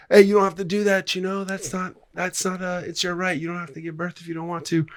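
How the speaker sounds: high-pitched acted protester. Protesters to women entering birthing clinic, high urging voice.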